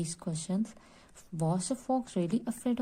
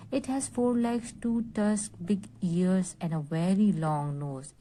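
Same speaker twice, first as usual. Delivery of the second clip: ordinary delivery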